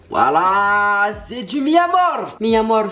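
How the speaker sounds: high, drawn-out reveal of the flavour; excited reveal. Real, not acted. Vlogger unveils the flavour to his viewers, thrilled. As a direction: announce it like a prize.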